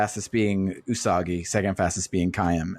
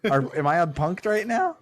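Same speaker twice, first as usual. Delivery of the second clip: incredulous; 6 semitones higher than usual. Retold or imitated. Incredulous reaction retold.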